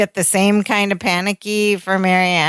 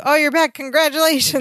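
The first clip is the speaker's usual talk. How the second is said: high-pitched imitated gushing; mock congratulations; 7 semitones higher than usual. Friends welcoming the supposed new parents, gushing. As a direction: greet them in a high, delighted voice.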